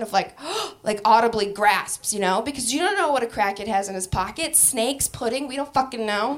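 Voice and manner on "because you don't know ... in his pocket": ordinary talk, nothing marked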